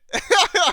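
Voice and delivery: sudden high burst of laughter; a real burst of laughter. Real, not acted. Laughs at own rant.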